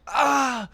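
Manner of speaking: high yelp of pain, imitated; imitating a kid's pained ah; mock pain yelp. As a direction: yelp like you stepped on a toy. Kid stepping on bones.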